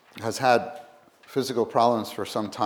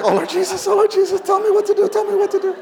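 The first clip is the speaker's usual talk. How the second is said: much higher, loud hammed-up pleading; mock desperate prayer; 8 dB louder, faster than usual. Wife praying frantically for a house, hammed-up pleading. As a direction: overplay a frantic prayer to Jesus. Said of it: "you gotta talk like this".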